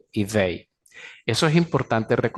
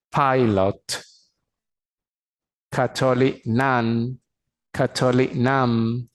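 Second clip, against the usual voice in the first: slow model; slower than usual. Shown as a demonstration. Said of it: "slow model".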